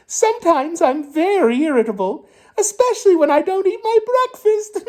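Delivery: high, eager little rascal voice; a rascal answers the pirate captain, high and eager; chirpy rascal. Acted. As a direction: pipe up like a proud rascal.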